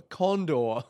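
laughing while saying it. Real, not acted. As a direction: blurt the word through laughter. Names a bird as a joke.

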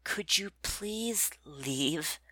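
slow, breathless whispered plea; strained courteous whisper. A narrator's reading. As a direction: hold back the magic while asking politely. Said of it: "I'm trying to be courteous".

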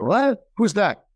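mock shocked what, who's that; startled disbelief. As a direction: jump way up in pitch, incredulous. Shocked at own recorded voice.

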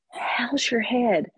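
exaggerated slow, patronizing question; imitated slow, loud talk. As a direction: talk to them slowly as if they can't understand. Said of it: "they speak louder and slower to you".

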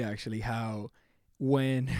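ordinary delivery

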